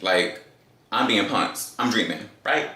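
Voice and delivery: ordinary delivery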